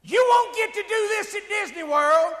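high, full-force shout over roaring motors; top-of-voice yell. Retold or imitated. Raft driver to his passengers heading for the waterfall, yelling at the top of his voice. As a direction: bellow it as a daredevil dare. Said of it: "he yells out at the top of his voice".

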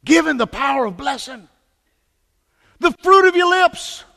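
full-throated revival preaching; preaching at full force. Acted. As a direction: drive it home from the pulpit.

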